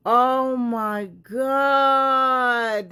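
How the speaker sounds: long, stretched, loud cry of disbelief; shocked cry. Real, not acted. Reacting to explosive claims, a long shocked cry. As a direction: drag it out in shock.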